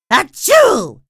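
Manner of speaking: loud high acted sneeze; big sneeze. SpongeBob sneezing at work, loud cartoon sneeze. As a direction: let the sneeze explode.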